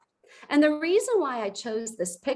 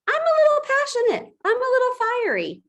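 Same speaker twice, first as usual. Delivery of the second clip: much higher, louder and fast, bursting with fire; fiery; 13 semitones higher, 4 dB louder, faster than usual. Shown as a demonstration. Coach showing her team her own fiery tone, high and fast. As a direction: show them your fiery tone. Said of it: "That's my tone".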